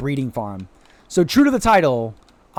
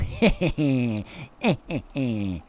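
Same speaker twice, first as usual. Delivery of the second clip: low, drawn-out impish cackle; mischievous chuckle; 5 semitones lower, 6 dB quieter than usual. Pretend. Mischievous duck streamer teasing chat, impish cackle. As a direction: snicker slowly like a schemer.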